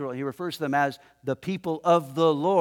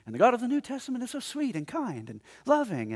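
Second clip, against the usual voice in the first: higher-pitched, singsong parody; mock sweetness; 8 semitones higher than usual. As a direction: gush it as a naive cliché. Parodies a mistaken view of God.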